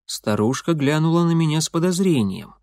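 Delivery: ordinary delivery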